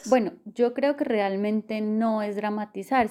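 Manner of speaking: normal talking voice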